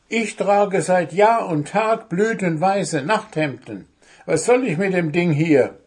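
high, indignant gnome voice; aggrieved storybook gnome protesting; complaining. A narrator's reading. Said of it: "beschwerte sich der Wichtel".

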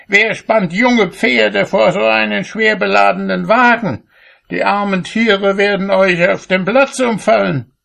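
high-pitched indignant rebuke; outraged call. A narrator's reading. Cobbler to farmer with overloaded wagon, high indignant shout. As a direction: cry out in high, scolding outrage. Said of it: "rief er".

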